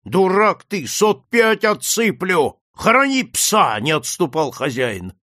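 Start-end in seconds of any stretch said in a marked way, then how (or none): 0.0-3.8 loud insistence. Acted. Master presses the priest.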